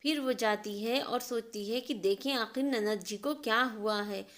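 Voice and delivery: ordinary delivery, nothing marked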